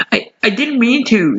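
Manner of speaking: high, stammering fear; frightened stammer. A narrator's reading. Carlos facing the huge wolf, scared stammer. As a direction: stutter it out, scared. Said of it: "started Carlos in fear".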